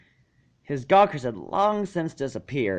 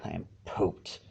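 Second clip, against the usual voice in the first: low, soft, worn-out mumble; mumbled in defeat; 7 semitones lower, 11 dB quieter than usual. A narrator's reading. Tired Spike mumbles to his teachers, low and soft. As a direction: mumble it, drained and beaten. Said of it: "He mumbled in defeat".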